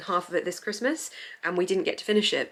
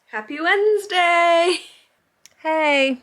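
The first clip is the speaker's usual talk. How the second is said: high, loud and slow sing-song hello; bright drawn-out greeting; cheery greeting; 10 semitones higher, 7 dB louder, much slower than usual. Real, not acted. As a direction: beam it out to the viewers.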